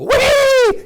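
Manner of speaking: very high, loud whoop; sudden wild outburst, pitched sky-high; acted wild squeal. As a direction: let out a huge gleeful shriek. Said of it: "to jump up and go".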